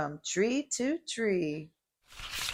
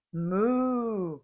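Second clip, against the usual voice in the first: calf moo; 2 semitones higher than usual. A narrator's reading. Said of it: "Calf called".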